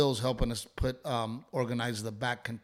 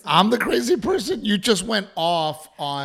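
high, loud outraged question; how dare you call me crazy; indignant disbelief; 8 semitones higher, 7 dB louder than usual. Real, not acted. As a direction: throw the accusation back, stunned. Partner called him crazy.

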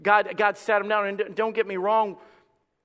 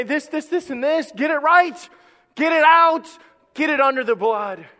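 loud, higher, rising to a shout; urgent demand; 7 semitones higher, 8 dB louder than usual. Acted. Preacher voicing God telling the church to get sin out, shouting. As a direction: hammer home the call to repent.

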